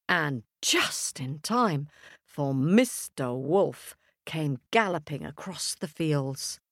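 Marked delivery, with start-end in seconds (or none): none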